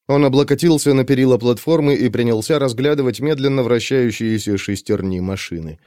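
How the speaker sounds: plain reading voice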